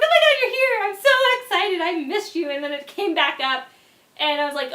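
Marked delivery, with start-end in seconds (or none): 0.0-2.5 much higher and louder, gushing joy; excited shout. Retold or imitated. Greeting the surprise visitor, ecstatic and loud. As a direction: scream your welcome at a friend. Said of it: "I got really excited".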